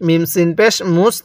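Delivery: usual speaking voice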